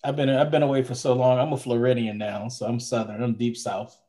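ordinary delivery

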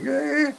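a sharp, high, dismissive "eh"; dismissive grunt. Retold or imitated. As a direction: brush her off with one jumpy grunt. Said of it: "inside I'm like".

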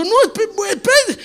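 high, slow, stammering protest; flustered stammer. Retold or imitated. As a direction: sputter in comic panic. Pastor flustered, baby left. (tartamudeo nervioso y agudo)

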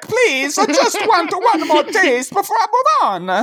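put-on pleading ghost drifting into another accent; Walken-ish accent. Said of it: "It was Christopher Walken".

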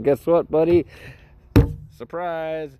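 spring a cruel surprise playfully; gleeful cartoon bully; mock taunt.

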